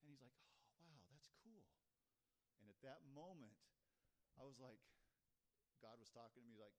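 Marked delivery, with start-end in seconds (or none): 0.3-1.7 impressed friend. Retold or imitated. Friend's unexpected admiring reply, retold.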